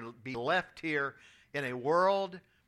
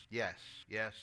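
weak reply; 8 semitones lower, 10 dB quieter than usual. Retold or imitated. Dying grandfather answers weakly.

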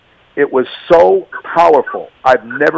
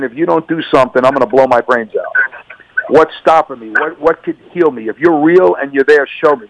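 ordinary talk, nothing marked

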